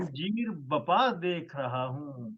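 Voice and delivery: measured, stately poetry recital; ghazal recitation. Acted. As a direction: recite each line with weight. Poet recites his ghazal.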